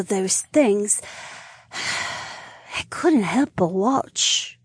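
longing sigh in voice; wistful young woman. Acted.

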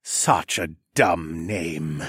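slow, low and raspy villain. A narrator's reading. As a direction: drag it out low and rough. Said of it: "in a low, raspy voice".

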